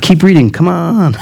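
high, pleading "keep reading"; mock impatient listeners. Preacher voicing restless listeners: mock eager plea. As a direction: play the congregation begging him to go on. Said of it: "You guys are like".

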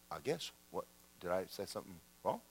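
small, soft, low voice, quickly mumbled; retold innocent reply, shrinking back quietly; meek reply. Pastor answering an offended woman, meek and quiet.

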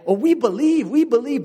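high, loud mock chorus; crowd protesting. Crowd insisting they believe, high and loud protest.

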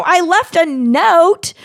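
high, slow, loud self-justifying; high indignant whine; defensive. Retold or imitated. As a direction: plead your defense. Defensive reply to friend.

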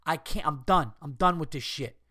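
ordinary talk, nothing marked

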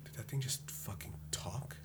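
hushed, breathed question; whispered disbelief. Acted. [name] shocked the rat spoke, hushed whisper. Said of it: "and I'm like".

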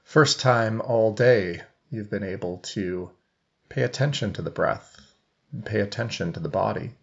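even meditation voice with long silences; guided meditation. Real, not acted. Coach leading seated listeners into breath awareness, guided meditation. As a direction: guide them gently, leaving long pauses between phrases.

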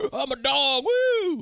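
high, loud crazy whoop; manic shout. Retold or imitated. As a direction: whoop like a maniac. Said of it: "This guy's crazy".

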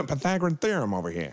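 high hawker call; market vendor calling. Pretend. Hawker mathematician bit.

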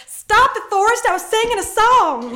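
high, loud mock shriek; indignant mock Jenny yelling at Forrest. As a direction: screech it at Forrest, hammed up. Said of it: "she's like".

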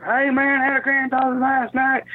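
high yelled heckle; homeless heckler yelling. Retold or imitated. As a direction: shout it like a drunk heckler. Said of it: "this guy yells".

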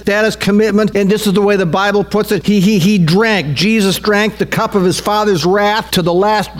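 sustained high-pitched sermon delivery; impassioned preaching. Real, not acted. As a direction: preach it urgently, pitched well up. Preaches with raised intensity.

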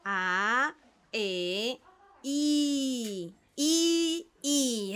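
trying out the I; pupil repeating vowels. Acted. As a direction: say the I over with wonder. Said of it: "Y la I acercando".

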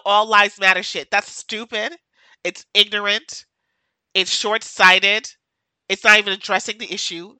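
ordinary delivery

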